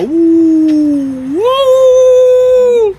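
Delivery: long rising falsetto "oooh"; mock alarmed "ooh". Streamer told of a complaint against him, mock high "oooh". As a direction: slide up into a loud high hoot.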